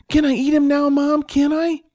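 put-on high, loud eager child; begging little boy. Johnny asks Mom after dinner, eagerly begging. Boy begs mother for treat.

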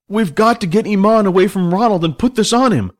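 high, urgent instruction. A narrator's reading. Billy tells his friends the plan mid-fight, urgent.

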